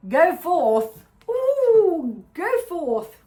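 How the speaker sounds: loud, high, playful fanfare; mock-heroic call; hammed-up proclamation. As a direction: proclaim it grandly, swooping up on the ooh.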